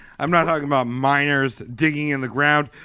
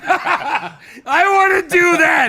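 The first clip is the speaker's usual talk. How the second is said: laughing shout; 11 semitones higher, much slower than usual. Real, not acted. Excited loud laughing quote.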